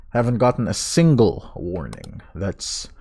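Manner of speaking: ordinary talk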